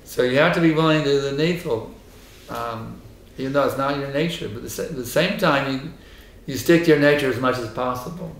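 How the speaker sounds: ordinary delivery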